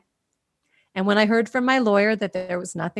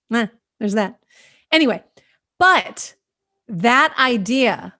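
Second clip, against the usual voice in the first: ordinary delivery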